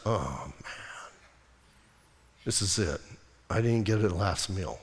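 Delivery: very low, hushed resignation; quietly acting a condemned man's dismay; dejected prisoner. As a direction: sink into a soft, defeated murmur. Barabbas acted as guards fetch him.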